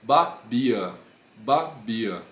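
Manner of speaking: Babia with a soft, unaspirated B. Shown as a demonstration. Teacher contrasts soft B with explosive P. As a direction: Babia slowly, no puff of air on the B. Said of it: "olha como que não se mexe".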